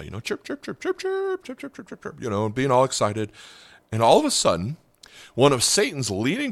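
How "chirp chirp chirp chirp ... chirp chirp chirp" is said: much higher, softer chirps like a tiny bird; joyful bird chirping. Retold or imitated. Preacher imitating the thawed bird chirping with joy, high and soft. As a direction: chirp it out like a happy little bird. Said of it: "he started to chirp with joy".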